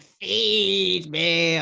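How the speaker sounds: lightly imitated whiny villain; a hint of whining hunger. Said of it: "with that whiny voice".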